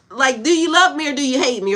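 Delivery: half-laughing exasperation; exasperated outburst. Real, not acted. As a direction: throw up your hands at them. Exasperated rhetorical question.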